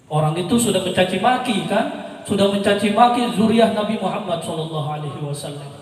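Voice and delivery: ordinary talk, nothing marked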